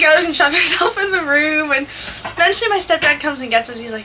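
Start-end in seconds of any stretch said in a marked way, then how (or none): none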